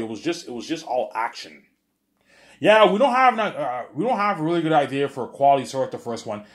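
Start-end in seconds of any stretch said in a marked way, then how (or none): 2.6-6.4 much higher and louder, scoffing; mock the lazy producers; mock studio voice. Streamer mocking studio executives for sequels, scoffing.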